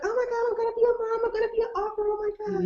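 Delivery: high, gushing new mom; mock excited mom-to-be. Expecting mother gushing about the baby, high and excited. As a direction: squeal over being a mom. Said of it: "You know, like".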